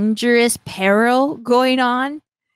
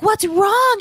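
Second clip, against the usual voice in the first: high worried voice; 12 semitones higher, 4 dB louder than usual. Retold or imitated. The agents asking the healer in a high voice. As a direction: pitch it way up, a bit louder. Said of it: "they're like".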